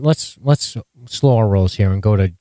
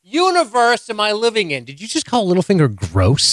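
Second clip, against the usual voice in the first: real shock at what she just heard; stunned disbelief; 11 semitones higher than usual. Real, not acted. Host reacting to co-host calling Littlefinger gross, much higher and stunned.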